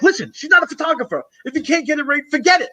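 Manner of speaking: high-pitched angry yell at the guy; retold scream. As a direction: snap at him like you're fed up. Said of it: "He screamed at me".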